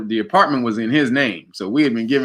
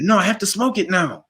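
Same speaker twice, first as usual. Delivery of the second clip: higher imitated Jamaican insistence; Jamaican accent; 8 semitones higher than usual. Roommate refusing to give up the joint, Jamaican accent. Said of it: "he was Jamaican".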